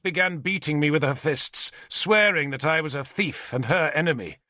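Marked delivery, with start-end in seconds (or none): none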